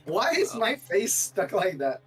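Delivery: usual speaking voice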